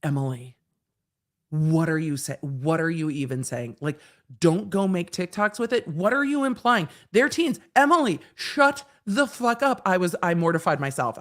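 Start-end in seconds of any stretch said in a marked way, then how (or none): 0.0-9.8 rising to an angry yell; exasperated self-scolding. Retold or imitated. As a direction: scold yourself, building louder. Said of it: "And I was like".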